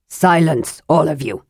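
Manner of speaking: rough, restrained roar; roared command. A narrator's reading. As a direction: roar for order without much volume. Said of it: "Hornfell roared".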